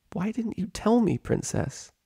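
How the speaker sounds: hurt question. Acted. Twilight asks her mentor.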